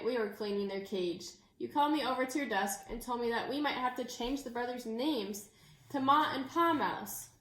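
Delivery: ordinary delivery, nothing marked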